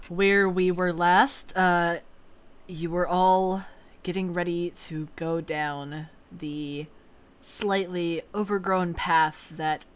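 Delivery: slow, soft scene-setting narration; narrator voice. Acted. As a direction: set the scene calmly for players. Game master scene setting.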